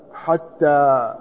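حتى drawn out very slowly with t; slow, careful ḥattā for حتى; spelled-out reading. Shown as a demonstration. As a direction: stretch حتى to show the doubled t. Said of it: "بالتاء المثنات من فوق".

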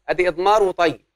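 normal talking voice

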